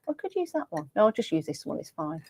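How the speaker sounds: ordinary talk, nothing marked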